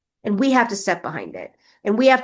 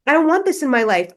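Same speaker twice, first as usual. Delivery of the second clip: louder and higher, fully committed; emphatic declaration; 6 semitones higher, 5 dB louder than usual. Real, not acted. Repeating her refusal to the listeners, loud and emphatic.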